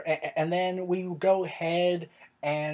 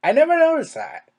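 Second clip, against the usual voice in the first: surprised reply; 8 semitones higher, 6 dB louder than usual. Retold or imitated. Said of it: "And I'm like".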